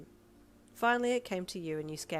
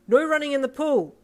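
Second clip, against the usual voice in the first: much higher, much louder command; authoritative shout; 9 semitones higher, 15 dB louder than usual. Acted. Teacher shouting after the girl running off, loud command. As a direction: bellow the pool rule. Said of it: "Ida shouted authoritatively".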